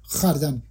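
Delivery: خردن said as "khʷardan"; old pronunciation. Shown as a demonstration. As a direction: glide from rounded kh into "a" in خردن. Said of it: "اگر با تلفظ قدیم بخوایم تلفظ کنیم".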